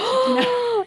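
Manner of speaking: collapsing into laughter at the end; laughing. Retold or imitated. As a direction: squeal the last words in a laugh. Quoting the rep, laughing.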